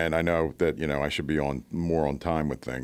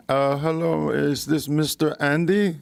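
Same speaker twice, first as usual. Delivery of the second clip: high, slow, put-on phone voice; mock call-center caller; 9 semitones higher, 3 dB louder, slower than usual. Call-center worker phoning a customer, mock caller voice. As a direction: draw it out as a hesitant caller, pitched up.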